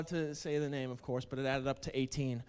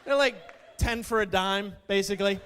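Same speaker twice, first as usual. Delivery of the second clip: loud mockery; 3 semitones higher, 5 dB louder than usual. Real, not acted. Mocking the hot dogs.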